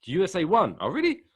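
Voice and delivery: high, loud astonished reaction; mock surprise. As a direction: act out being amazed and puzzled. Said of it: "That's what I was like".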